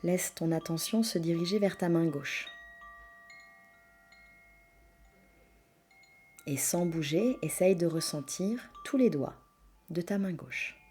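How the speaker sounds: soft, hushed yoga nidra voice with long pauses; guided meditation. Acted. Leads a yoga nidra relaxation.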